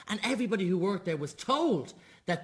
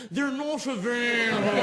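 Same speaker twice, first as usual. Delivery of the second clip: put-on posh accent; 4 semitones higher, 3 dB louder than usual. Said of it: "a nicer accent".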